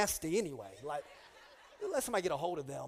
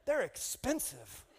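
weary aside; 4 dB quieter, much slower than usual. Retold or imitated. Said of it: "I was like".

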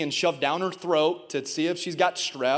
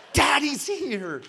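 high, drawn-out call of reassurance; emphatic reassurance; 8 semitones higher, slower than usual. Retold or imitated. Father answering his frightened daughter at the doctor, high emphatic reassurance. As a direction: stretch each word out, pitched high.

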